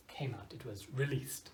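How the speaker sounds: whispered aside. Real, not acted. Teacher explaining a phrase to students in a whisper. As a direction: breathe the gloss as a quiet aside.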